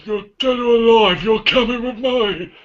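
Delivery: high, slow robotic cop voice; stiff, slow and high like RoboCop. Pretend. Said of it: "how would you talk if you was Robocop".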